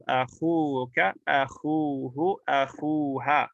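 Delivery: akhuka, akhuhu, akhuha, each khu held long; long-vowel form. Shown as a demonstration. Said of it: "easy to pronounce".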